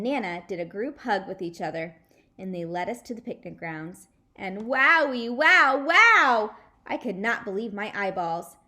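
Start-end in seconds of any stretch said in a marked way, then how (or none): 4.3-6.5 louder and louder wows of wonder; amazed. Acted. Junie B. seeing the glass pool, rising loud wows. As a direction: build it up in delight.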